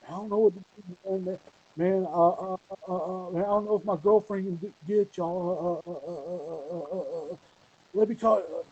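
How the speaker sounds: high, stammering unsure friend; stammering Drake. Retold or imitated. Drake stalling on the phone, high and stammering. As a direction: hem and haw, lots of uh. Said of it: "And Drake's like".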